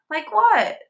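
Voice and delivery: high, mocking like what; cheeky backchat. A narrator's reading. Girl talking back to the teacher, high and cheeky. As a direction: play dumb with attitude.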